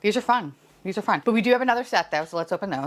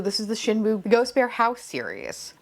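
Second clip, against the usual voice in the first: normal talking voice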